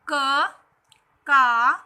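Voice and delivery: "क", "का" loud and separate, each vowel held; syllable recitation. Shown as a demonstration. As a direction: drill "क", then long "का", with a pause between. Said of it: "इनको हम इस तरीके से पढ़ेंगे". ("क", "का" ज़ोर से, अलग-अलग उच्चारण)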